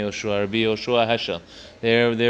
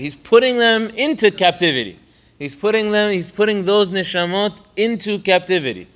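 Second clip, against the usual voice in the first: higher and louder, driving the point home; fervent emphasis; 8 semitones higher, 4 dB louder than usual. Real, not acted. Teacher stresses the sin to his student, fervent and raised. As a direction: press each repeat harder.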